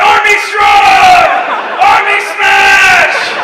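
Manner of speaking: huge slow roaring shout; drawn-out, full-force monster yell; Incredible Hulk. Acted. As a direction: bellow each word like a dim raging monster. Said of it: "written by the Incredible Hulk".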